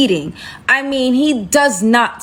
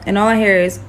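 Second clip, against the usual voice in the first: normal talking voice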